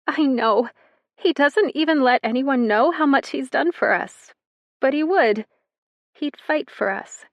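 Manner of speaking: a hint of a tearful child; young girl. Girl talking about her brother, lightly tearful.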